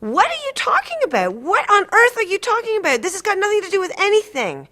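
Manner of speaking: patient protesting being dropped by her therapist, high, loud, desperate; desperate upset shout. Retold or imitated. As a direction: beg him not to end it, frantic.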